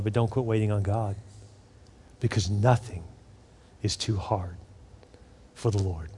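quiet pastoral emphasis; hushed conviction. Real, not acted. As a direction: let it sink to an earnest hush. Sermon climax hushed.

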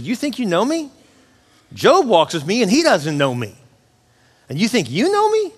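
high, challenging questions, pitch swooping up; getting on them. Retold or imitated. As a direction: challenge them as an affronted God, in imitation. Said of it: "God gets on Job's friends".

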